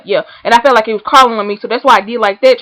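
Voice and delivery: ordinary delivery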